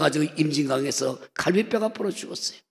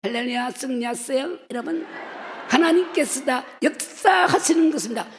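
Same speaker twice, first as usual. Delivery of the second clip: put-on high, airy old revivalist pastor voice; impression of pastor; 7 semitones higher than usual. 유명 부흥사 목사의 목소리를 흉내 내며 높은 톤으로. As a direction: mimic a famous preacher's lofty hallelujah. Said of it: "최정전 목사님 목소리 몰라요?".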